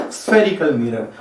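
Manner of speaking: ordinary talk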